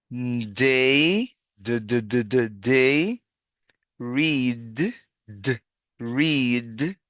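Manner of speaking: "d" as "duh", then "day, read, rained" drawn out; articulated D sound. Shown as a demonstration. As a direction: release the final D in "read" and "rained". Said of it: "con esa misma articulación".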